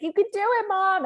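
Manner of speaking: put-on high, bright child's cheer; voicing the children's encouragement, high and eager; kids cheering. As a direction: cheer her on like an eager kid.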